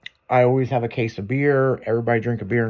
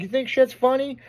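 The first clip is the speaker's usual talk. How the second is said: much higher, mock-enraged jab; angry taunt; 11 semitones higher than usual. Manager kicking his employee on the floor, mock rage. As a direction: throw it at him like a mafia boss mid-fight. Retold beating employee with seat.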